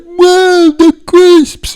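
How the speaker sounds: put-on high, loud childish delight; hammed-up childlike host; infantile Greg Wallace. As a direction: squeal over the crisps like a big kid. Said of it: "very infantile".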